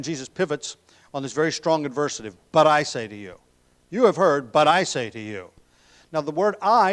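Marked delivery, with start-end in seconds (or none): 2.5-5.5 louder, ringing pulpit proclamation; proclaiming loudly. Acted. Preacher thundering Jesus' words to the congregation, loud. 6.6-6.9 "I" punched high and loud; stress on I. Shown as a demonstration. Preacher stressing "I" to explain the Greek, high and loud. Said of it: "is emphatic in the Greek there".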